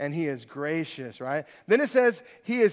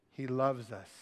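hushed, low and gentle; quiet, low comfort after a pause; tender reassurance; 8 semitones lower, 8 dB quieter than usual. Real, not acted. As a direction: let it drop softly to console. Preacher reassures the forgotten.

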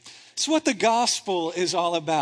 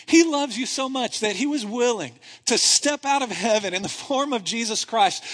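ordinary talk, nothing marked

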